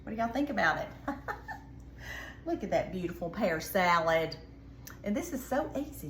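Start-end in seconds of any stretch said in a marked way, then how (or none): 0.9-2.4 airy, voiceless giggle; breathy giggle. Real, not acted. Proud cook giggling to viewers over her pear salad, light giggle. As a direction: giggle softly at the plate.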